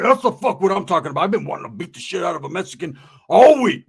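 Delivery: loud, eager fight talk, acted; hyped-up challenger. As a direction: build to a loud punch at the end. Hyped rival accepts the fight.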